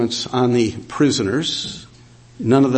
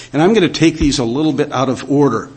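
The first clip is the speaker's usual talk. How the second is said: ordinary delivery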